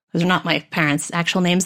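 ordinary delivery

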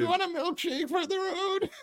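put-on very high, lightly trembling old-lady voice; sweet old grandma defending him. Grandma telling the cops he's a good boy, very high old-lady voice.

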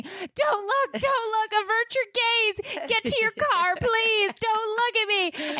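put-on very high frantic screaming at the kid; retold frantic scream. Mom shrieking at a staring boy, frantic high scream. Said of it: "and I'm just screaming".

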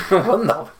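laughing German exclamation, high; a jokey German "Wunderbar"; teasing the actor's fake German; mock German. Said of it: "Playing a German".

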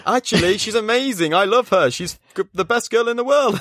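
high, hammed-up backtracking gush; panicked gushing praise. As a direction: overdo the praise because she is listening. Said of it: "then he clocks her outside the door".

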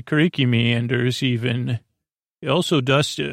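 normal talking voice

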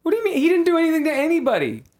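high, fast, loud protest; hammed-up outrage at the bounty hunter; shrill outrage. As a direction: yell it in mock disbelief. Acted outrage at the shooter.

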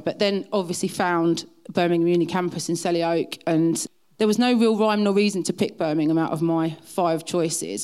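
ordinary delivery

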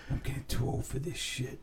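soft, weary movie-cop impression; movie-character quote. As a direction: mutter it like a tired film cop. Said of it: "I just like the way he's".

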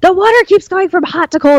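high-pitched exasperated whine; retold screaming complaint. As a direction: complain upstairs in a mock yell. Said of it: "And I started screaming".

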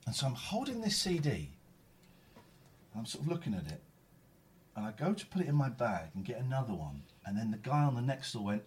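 ordinary talk, nothing marked

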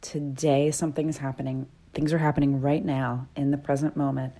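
ordinary delivery

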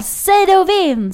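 high, loud, slow DJ shout of "säg det och vinn"; radio slogan. Pretend. Fake radio DJ calling a classmate, belting the slogan.

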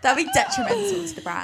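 mock-serious line broken by giggles; laughing. Real, not acted. Co-host joking about the brand, laughing as she talks.

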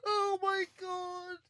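high, soft, drawn-out wail; spent, slow high-pitched gasp; weak from laughing. Real, not acted. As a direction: squeak it out faintly after hysterics. Host weak from hysterical laughing.

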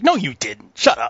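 high, loud imitation of a fed-up guy; exasperated mock outburst, climbing in pitch; mock snapping. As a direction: shoot down a braggart, hammed-up. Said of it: "the dude is just snapped".

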